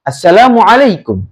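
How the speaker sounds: "Assalamualaikum" dropping at the end; falling tone. Shown as a demonstration. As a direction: bring the end of "Assalamualaikum" down, not a question. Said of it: "nadanya kan rendah ya". (nada turun di akhir "Assalamualaikum")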